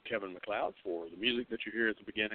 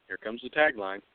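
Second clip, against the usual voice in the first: usual speaking voice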